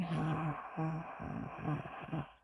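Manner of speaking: soft, breath-only imitated laugh; mock laugh. Retelling how he laughed at the begging, mock laugh. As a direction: imitate his laughing in quiet, airy ha-has. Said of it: "he starts laughing".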